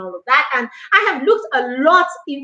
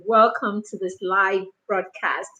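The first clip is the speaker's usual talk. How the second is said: ordinary talk, nothing marked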